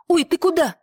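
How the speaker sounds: high-pitched, startled cook; startled cry. A narrator's reading. Cook startled by the escaping animal.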